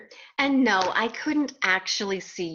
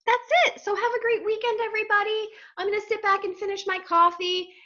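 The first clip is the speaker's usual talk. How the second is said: cheery sign-off; 11 semitones higher than usual. Real, not acted. Happy sign-off.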